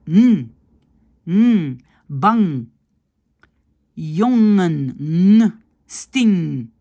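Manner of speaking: bang, jongen, sting held on a nasal ng, no g; loud, isolated words humming out the ng; soft ng sound. Shown as a demonstration. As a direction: let bang and sting end on a pure ng. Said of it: "le N G, c'est N".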